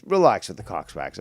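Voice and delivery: ordinary delivery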